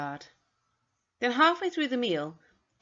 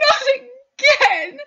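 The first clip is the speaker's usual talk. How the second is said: much higher, louder, slow howl on Not again; a second, louder sob-shout; sobbing shout; 15 dB louder, much slower than usual. Acted. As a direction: cry it out as the despair peaks. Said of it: "Missus Carter began to sob".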